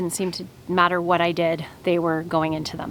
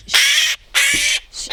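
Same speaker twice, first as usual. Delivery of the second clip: very high hushing; shushing; 3 dB louder, much slower than usual. Real, not acted. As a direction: soothe it with shh. Hushing the fledgling.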